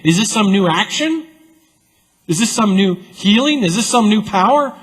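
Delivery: high, rising amazed questions; wondering questions. Acted. Scribes hypothetically wondering aloud, rising amazement. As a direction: ask each one in mounting wonder. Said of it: "He didn't say".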